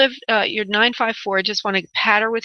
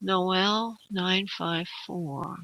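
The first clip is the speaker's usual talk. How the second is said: slow, soft murmur while concentrating; 8 dB quieter, much slower than usual. Real, not acted. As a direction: draw the words out softly, as if sensing. Medium tuning into caller's energy.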